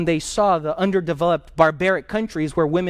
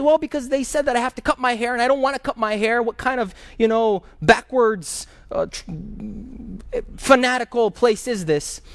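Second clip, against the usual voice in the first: put-on higher, aggrieved voice of a young man near tears; upset student; 6 semitones higher than usual. Expelled student complains to the teacher, higher and tearful. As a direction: plead that the school is throwing you out. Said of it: "he's crying inconsolably".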